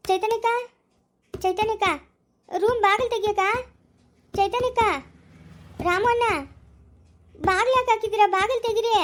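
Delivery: high, urgent calls through a door; calling out for help. Acted.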